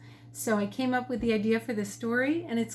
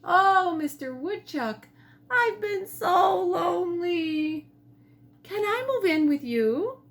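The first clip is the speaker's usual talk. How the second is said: pleading pheasant; 7 semitones higher, 4 dB louder than usual. A narrator's reading. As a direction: beg the woodchuck with longing. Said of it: "Pheasant asked".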